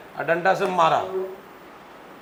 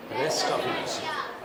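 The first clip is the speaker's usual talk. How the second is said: drawn-out prompt; 6 semitones lower, 8 dB quieter, much slower than usual. Shown as a demonstration. Teacher prompting the term.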